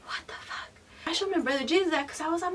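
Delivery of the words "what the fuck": stunned whisper under the breath; hushed mock disbelief; whispered bafflement. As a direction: whisper it, utterly puzzled. Retold reaction to weird text.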